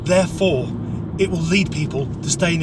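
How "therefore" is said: usual speaking voice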